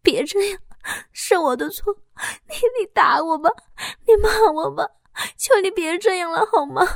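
high, fast, tearful begging; frightened pleading. Acted. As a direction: beg him in panic to stop. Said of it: "恐惧地说". (妻子恐惧地哭着哀求丈夫)

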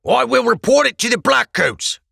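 high, loud threat; warning. Acted. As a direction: warn him sharply, loud and high. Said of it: "he warned".

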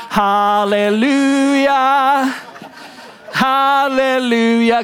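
slow, put-on churchgoer hallelujah; forced hallelujah. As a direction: drag it out like you're faking joy. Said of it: "You walk in".

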